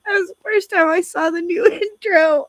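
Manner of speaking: teary laughing apology; laughing through tears; hoarse cry. Real, not acted. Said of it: "I'm sorry".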